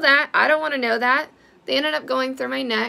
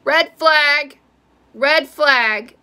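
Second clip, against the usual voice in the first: loud urgent warning cry; mock alarm bell, loud and urgent; alarmed inner shout; 3 semitones higher, 8 dB louder, much slower than usual. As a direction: sound the alarm in your head.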